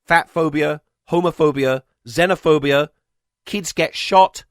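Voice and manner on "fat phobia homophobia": usual speaking voice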